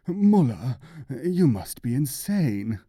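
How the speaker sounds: loud, shocked cry of outrage; scandalized. A narrator's reading. Sick theologian scandalized by the mullah's prayer, outraged cry. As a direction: recoil in outrage at the blasphemy.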